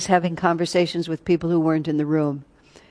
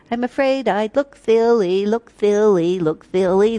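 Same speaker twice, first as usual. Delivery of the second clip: timid robin; 2 semitones higher, 5 dB louder than usual. Acted. The robin in the children's song, fretting in a mock-timid voice. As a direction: play the shy robin, fretting. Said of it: "That's what the robin says".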